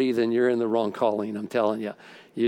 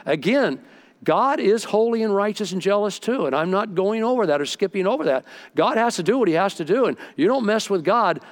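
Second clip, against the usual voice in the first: preaching; 4 semitones higher than usual. Acted. Pastor pressing the congregation with rising fervor. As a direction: climb into full preaching fervor.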